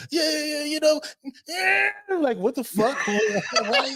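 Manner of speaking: high, eager yeah yeah; imitated excited witness. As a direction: mimic the overexcited interviewee. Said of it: "the news just".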